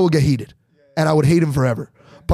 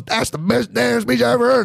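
impressed fan; 7 semitones higher than usual. Retold or imitated. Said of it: "the guy goes".

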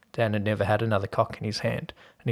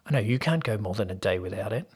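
plain reading voice

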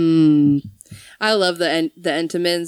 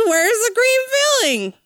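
"Where's the cream" lifted high; a high quoted cry of "Where's the cream filling?"; quoting the ad. Retold or imitated. Friend retelling the commercial, quoting the snowboarder high. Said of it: "And he says".

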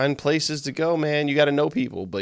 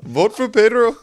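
Pedro line, higher and louder; 10 semitones higher, 7 dB louder than usual. Retold or imitated. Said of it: "sound like Pedro from fucking Napoleon Dynamite".

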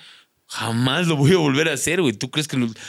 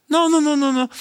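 high, louder string of no's; panicked denial; 5 dB louder than usual. Retold or imitated. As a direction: protest in alarm at the fine. Reacting to the huge fine.

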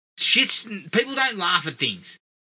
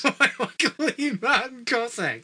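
laughing; 5 semitones higher than usual. Real, not acted. Host baffled by his name mistake, laughing. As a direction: question squeezed out through laughter.

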